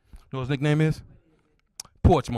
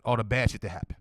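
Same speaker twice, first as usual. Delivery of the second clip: ordinary talk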